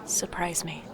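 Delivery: whispered reply. Acted. Micah whispering the order back to the sister. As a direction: drop to a whisper.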